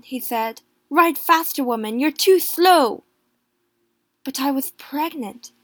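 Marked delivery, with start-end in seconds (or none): 0.9-3.0 much louder, higher snapping order; yelling back at a lagging rider; impatient shout. A narrator's reading. Husband urging wife to ride.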